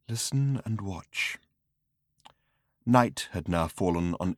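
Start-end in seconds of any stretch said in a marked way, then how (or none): 0.1-1.4 hushed voice. Acted. Slartibartfast hushing.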